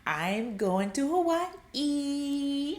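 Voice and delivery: slow, high, drawn-out acted glee; mock giddy anticipation. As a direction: stretch the destination into a squeal. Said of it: "That's what gets you giddy".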